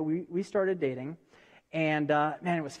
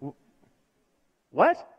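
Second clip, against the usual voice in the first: stunned surprise; 4 dB louder than usual. Retold or imitated. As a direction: blurt it out in disbelief. Shocked at brother's news.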